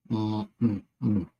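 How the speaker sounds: ordinary talk, nothing marked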